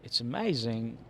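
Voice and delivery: usual speaking voice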